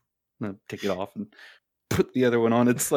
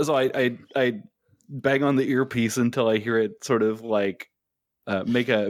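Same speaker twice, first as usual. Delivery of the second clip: ordinary talk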